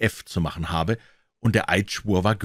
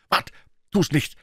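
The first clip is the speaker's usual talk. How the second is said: higher, quick warning cry; urgent call; 9 semitones higher than usual. A narrator's reading. Tom stops Huck before he scratches himself, calling out. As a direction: call out to stop him. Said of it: "als Tom rief".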